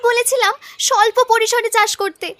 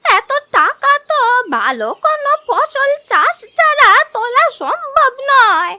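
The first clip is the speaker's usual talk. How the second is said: put-on high, slow elderly voice; worried old grandmother; 3 semitones higher than usual. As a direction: draw the words out like an anxious old woman. Grandmother questions the promise to repay.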